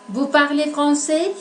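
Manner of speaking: "Vous parlez français?" with the voice lifting on "français"; rising question tune. Shown as a demonstration. Teacher showing students a yes-no question, rising at the end. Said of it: "Elevamos la voz al final de la frase".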